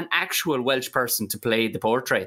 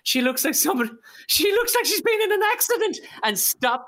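incredulous. Real, not acted. Mocking Bev's looks.

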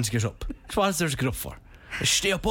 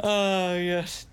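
usual speaking voice

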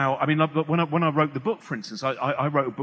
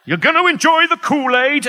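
very high, loud yell; mock shout; 12 semitones higher, 10 dB louder than usual. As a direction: holler it out like a crazed order. Mock outburst during radio producer story.

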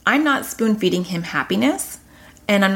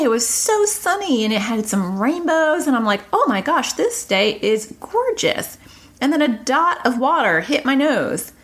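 mock delight; 7 semitones higher than usual. Mom acts wonder at sunny day.

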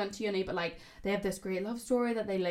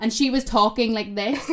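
loud mock tipsy talker; imitating drunken loudness; 9 dB louder than usual. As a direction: imitate someone talking far too loudly. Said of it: "getting really, really loud".

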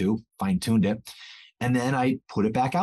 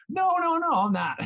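very high, playful denial; high-pitched comic protesting; mock protest. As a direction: squeak out a hasty mock denial. Teacher protesting to accusing pupils.

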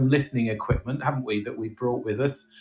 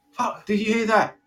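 mock fright; 10 semitones higher than usual.

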